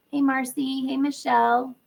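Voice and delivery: ordinary talk, nothing marked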